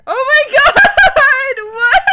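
slow shout breaking into laughter; scream into giggles. Real, not acted. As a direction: shriek then crack up. Scare turning to laughter.

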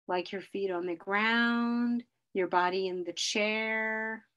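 slow, calm grounding guide; guided meditation. Acted. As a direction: lead them slowly through the senses. Leads a grounding exercise.

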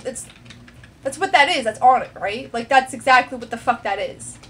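ordinary delivery

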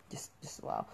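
breathless awed whisper. Real, not acted. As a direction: whisper it in awe.

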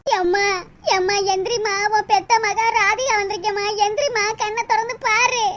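soft, tearful pleading; weeping. Acted. Daughter begging her mother to get up, weeping. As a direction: plead through sobs, fading into a thin wail. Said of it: "Radhika எப்படி அழுகுறான்னு பாருங்க".

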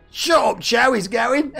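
playful high-pitched shout; mock heckle. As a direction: jokingly yell over yourself. Mock heckle, then laughs.

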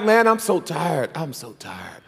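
hammed-up worn-out churchgoer; mock tired whine. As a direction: fade out like you can barely move. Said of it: "You're like".

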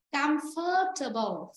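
comfortable as "cam-phờ-tơ-bồ", stress first; correct pronunciation. Shown as a demonstration. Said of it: "Phơ huyền đấy". (comfortable nhấn âm đầu, "phờ" dấu huyền)

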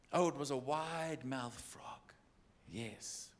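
hushed, breathy aside to the crowd; whispered aside. Real, not acted. Preacher whispering to the congregation before the joke. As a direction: lean in and whisper it.

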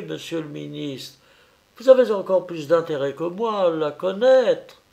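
voix plus haute, lente et narquoise; le policier qui savoure son avance; policier ironique. A narrator's reading. Voix du policier Petit Gris. (le policier Petit Gris nargue le ministre, voix plus haute et ironique)

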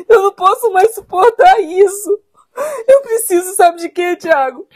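crying, anguished plea; tearful despair. Acted. Wife begging husband to defend her, crying in despair. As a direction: plead through tears, worn to breaking.

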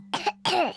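small sick cough. Acted. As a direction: cough like a poorly child. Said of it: "You don't sound well".

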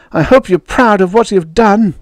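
high, bitter accusation; heated high-pitched reproach; angry shout. A narrator's reading. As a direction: throw it at them in fury. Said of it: "she shouted".

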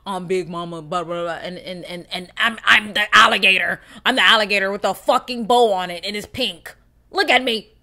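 put-on loud, brassy tough-girl bravado; loud swaggering persona, showing off; Big Mama persona. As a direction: puff up and show off as the big boss. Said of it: "I put on this persona of".

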